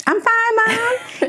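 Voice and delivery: imitated high, strained reassurance; holding back tears. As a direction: put on a high, loud "fine" while fighting tears. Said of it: "I was trying to hold it back".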